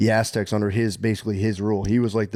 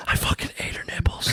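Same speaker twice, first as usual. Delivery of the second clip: hushed, gleeful whisper; playful conspiratorial hiss; whispered mock confession; 4 dB quieter than usual. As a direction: whisper it like a guilty joke secret. Mock secret confession in night-time bit.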